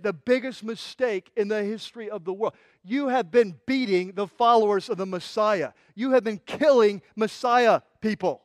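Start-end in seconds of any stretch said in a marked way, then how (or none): none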